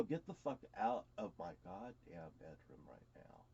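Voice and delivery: subdued, firm dismissal; low retold order. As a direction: repeat the order quietly and low. Repeats the order to niece, lower.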